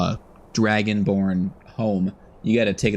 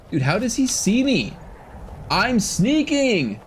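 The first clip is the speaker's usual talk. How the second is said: surprised; 13 semitones higher, slower than usual. Real, not acted. Spotted by troll while sneaking.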